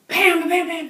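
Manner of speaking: loud, punchy mock gunshots; imitated gunfire. Storyteller mimicking bullets hitting the tree, loud gunfire bams. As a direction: bam them out like rapid fire.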